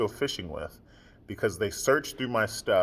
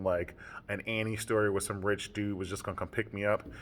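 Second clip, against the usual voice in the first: normal talking voice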